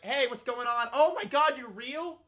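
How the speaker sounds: high-pitched mock astonishment; imitated surprise. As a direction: imitate her startled realization, pitch leaping up. Imitates drunk woman surprised by cops.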